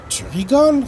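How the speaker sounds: high, loud disbelief; incredulous retort. Acted. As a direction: scoff at his cousin. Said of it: "rétorque Ludo". (réplique incrédule, plus aiguë)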